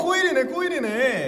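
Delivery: high, laughing delight; excited laughing. Real, not acted. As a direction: laugh as the guess comes true. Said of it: "guessed it right".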